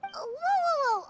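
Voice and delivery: high, startled cry to stop them; startled whoa. Acted. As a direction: jump in, caught off guard. Startled by an unknown project.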